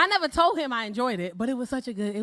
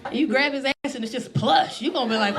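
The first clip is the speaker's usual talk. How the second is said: ordinary talk, nothing marked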